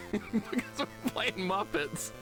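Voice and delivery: high, slow words broken by laughter; laughing through words. Real, not acted. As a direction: struggle to speak while cracking up.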